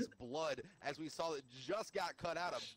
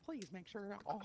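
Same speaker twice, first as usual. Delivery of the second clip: airplane stewardess; 6 semitones higher, 4 dB quieter than usual. Retold or imitated. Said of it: "airplane stewardess".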